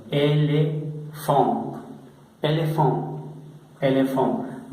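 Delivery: "elefant" said slowly the French way, with a nasal final syllable and no final e; French pronunciation. Shown as a demonstration. Teacher repeating a French word slowly for the class, French "elefant". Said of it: "como en el español".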